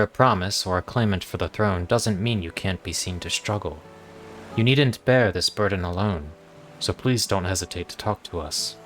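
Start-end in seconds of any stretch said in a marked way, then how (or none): none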